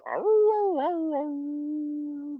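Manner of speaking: high, drawn-out mock howls; imitated dogs barking. Man imitating karaoke singers as barking dogs, high howling. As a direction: howl like a pack of dogs. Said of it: "it sounds like a bunch of dogs barking".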